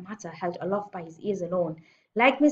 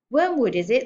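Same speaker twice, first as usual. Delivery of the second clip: higher, louder pointed query; 5 semitones higher, 9 dB louder than usual. A narrator's reading. Headmistress recognises the girl's surname, pointedly. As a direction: pounce on the name.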